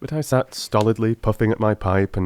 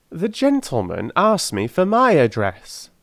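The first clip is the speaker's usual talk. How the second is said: put-on high, pleading old crone voice; a poor old charwoman begging humbly; old woman; 10 semitones higher, 4 dB louder than usual. Old woman begs the gentlemen for the ring, high and humble.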